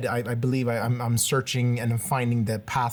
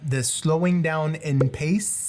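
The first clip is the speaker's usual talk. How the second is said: slow, stretched delivery; deliberately slowed pace; 3 semitones higher, slower than usual. Shown as a demonstration. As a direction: draw the words out to match their meaning. Slows while naming slowing down.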